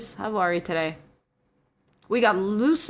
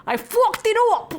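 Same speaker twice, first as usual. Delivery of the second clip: high, loud mock British accent; 13 semitones higher, 6 dB louder than usual. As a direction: read it in an exaggerated British accent. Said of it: "I read it with a n-, British accent".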